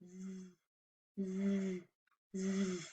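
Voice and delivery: "Z" as a soft, long buzzing zzz; Z sound. Shown as a demonstration. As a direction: buzz "Z" quietly like a bee. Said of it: "It goes like this".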